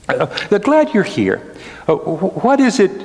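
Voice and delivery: a high, hurried king, a little flustered; lightly voiced king, jumping up in pitch. Preacher voicing the king asking Haman, flustered and high.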